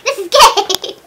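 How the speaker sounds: high, loud, laughing complaint; laughing mock outrage. Real, not acted. Joking with her friend in laughing mock outrage. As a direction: fake frustration through laughter.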